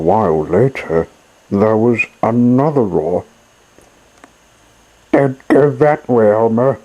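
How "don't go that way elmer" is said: higher, louder call from a lightly voiced monkey; monkey warning. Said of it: "said the monkey, swinging through the trees".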